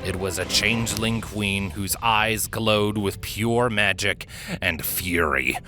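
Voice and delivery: plain reading voice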